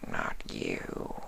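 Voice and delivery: a starving creature barely able to speak; weak changeling. A narrator's reading. Changeling replies.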